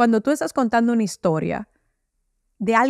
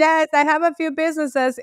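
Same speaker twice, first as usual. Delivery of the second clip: a touch higher, nervous mock pitch in English; insecure English intro; 6 semitones higher than usual. Her younger self pitching to Americans, nervously laughing. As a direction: finish the timid self-introduction with a shaky laugh. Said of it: "es bajar el tono de voz".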